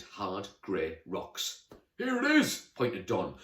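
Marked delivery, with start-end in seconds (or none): 2.0-2.7 very high, loud herald's cry; grand announcement. Acted. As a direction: proclaim the discovery like a knight. Knight announces the mountain.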